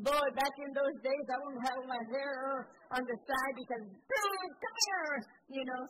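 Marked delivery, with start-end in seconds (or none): 3.9-5.1 sharp high call of come here; mock parent's call. Mother summoning a child for a spanking, sharp high call.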